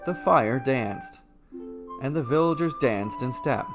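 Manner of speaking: ordinary delivery, nothing marked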